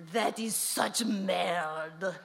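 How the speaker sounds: put-on slow, hissed French accent whisper; a snooty French matriarch. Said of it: "I do a splendid French accent".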